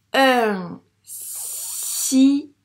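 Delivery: "signe" loud, split as si-gneu; syllable by syllable. Shown as a demonstration. Teacher correcting reading, "signe" loudly split in syllables. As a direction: stretch "signe" into long si and separate gne. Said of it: "elles forment le son gne".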